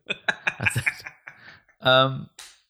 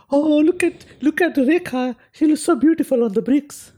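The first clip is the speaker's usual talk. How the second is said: much higher, louder gushing fan voice; gushing fan impression; 6 dB louder than usual. Mocking locals admiring a mural of a film star, in a much higher, louder gush. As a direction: swoon over the mural in a high, loud mock gush. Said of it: "everyone's just like".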